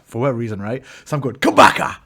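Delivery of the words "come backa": loud, punchy fighting yell; retold shout. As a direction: shout it in time with a blow. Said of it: "hear me shout".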